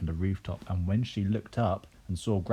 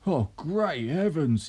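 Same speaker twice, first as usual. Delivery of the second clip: high, drawn-out exclamation; astonished cry; 7 semitones higher, 4 dB louder, slower than usual. A narrator's reading. Mr Cranky seeing the hen, high astonished cry. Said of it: "he cried".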